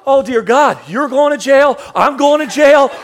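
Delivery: high, loud alarmed rant; horrified, everything's ruined; panicked coach. Retold or imitated. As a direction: coach panicking at bodies on the floor. Panicked coach sees bodies.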